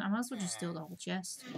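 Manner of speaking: ordinary delivery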